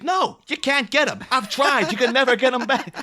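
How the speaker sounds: high, loud exasperated outburst; mock despairing yell, utterly defeated; Richard Kind. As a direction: protest in hammed-up exasperation. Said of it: "you can tell it exasperates him".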